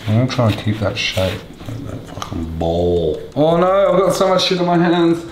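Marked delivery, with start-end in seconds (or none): 3.3-5.2 high, loud little wail; high mock dismay. Cook with messy hands, high mock dismay. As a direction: whine it high in mock distress.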